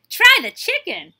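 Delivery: high, loud, drawn-out urging; crying out. A narrator's reading. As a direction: shout it as an eager offer. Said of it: "he cried".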